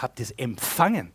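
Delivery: EMPFANGEN punched out with a pitch jump; "empfangen" stressed as the key word. Shown as a demonstration. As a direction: lean hard on the word empfangen. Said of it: "Die Betonung liegt auf empfangen".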